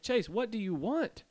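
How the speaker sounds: high, drawn-out retold demand; exasperated calling out. Showering man to prankster outside the door, exasperated call. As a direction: call the name, stretching the annoyed question.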